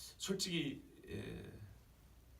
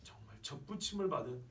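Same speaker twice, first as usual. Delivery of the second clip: hushed, awed admission fading softer; humbled realization; 7 dB quieter than usual. Acted. Preacher voicing believers who were wild olives, humbled realization. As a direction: sigh it out as it dawns. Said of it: "이렇게 깨닫는 겁니다".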